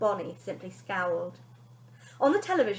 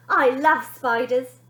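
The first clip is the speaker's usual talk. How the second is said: put-on slow, loud child's declaration; slow proud little boy; boy's first words; 2 semitones higher, 9 dB louder, slower than usual. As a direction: announce it slowly and proudly.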